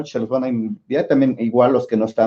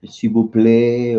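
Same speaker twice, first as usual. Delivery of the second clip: slow, careful French "s'il vous plaît"; French pronunciation; much slower than usual. Shown as a demonstration. A teacher showing students a French phrase, said slowly in French. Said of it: "cómo se dice".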